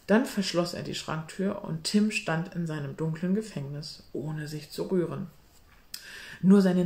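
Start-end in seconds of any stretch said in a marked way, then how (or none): none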